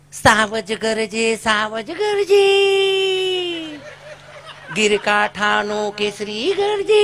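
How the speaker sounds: very slow, drawn-out prissy recitation; prim teacher recital. Retold or imitated. English-medium teacher reciting the lion poem to pupils, slow and prim. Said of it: "ઈ બેને જે ઉપાડ કર્યો".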